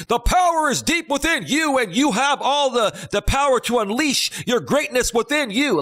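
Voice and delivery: unleash their greatness like a big loud guru; booming Tony Robbins. Retold or imitated. Imitating Tony Robbins's loud voice on a late-night TV infomercial. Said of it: "comes on with a loud voice saying".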